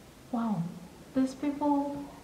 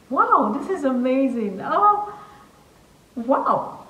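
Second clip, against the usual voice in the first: loud, raised-pitch astonishment; wowed, voice jumping up in pitch; amazed; 4 semitones higher, 6 dB louder, slower than usual. Real, not acted. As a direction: exclaim loudly, bowled over. Amazed at German inventions.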